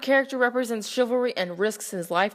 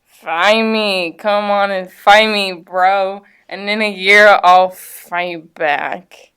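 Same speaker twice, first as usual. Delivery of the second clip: put-on loud, slurred drunk challenge; drunk slurring; 2 semitones lower, 11 dB louder, much slower than usual. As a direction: drag out the last words like a drunk. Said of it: "drunk Uncle stumbled in, slurring his words".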